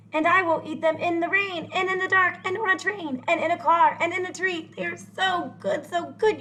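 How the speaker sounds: high, eager and bubbly; delighted. Acted. Grump who now loves green eggs, high delighted gushing. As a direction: gush with new delight.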